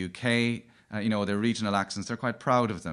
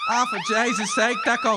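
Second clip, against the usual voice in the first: high, loud Dublin thief voice; thick Dublin accent; 13 semitones higher, 4 dB louder than usual. Acted. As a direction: play a Dublin robber caught out, high and loud. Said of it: "You can tell from the big accents on us".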